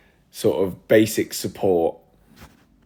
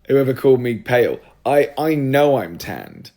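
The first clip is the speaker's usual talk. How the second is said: raised, louder, affronted insistence; higher-pitched offended complaint; indignant protest; 5 semitones higher, 6 dB louder than usual. Real, not acted. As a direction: insist on it, pride wounded. Protests being called pale.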